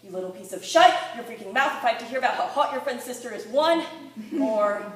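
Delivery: loud, punched mock tirade; performed exasperated shout, hammering the last words; shouted outburst. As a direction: burst out shouting mid-poem. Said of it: "made me want to shout four-letter words".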